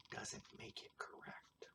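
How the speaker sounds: very soft, whispered aside. Real, not acted. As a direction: drop to a hushed whisper. Whispered aside in pronunciation sign-off.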